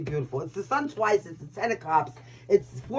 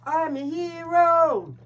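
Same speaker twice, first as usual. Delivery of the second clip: mock hero; 7 semitones higher, much slower than usual. Mock heroic boast after hero card.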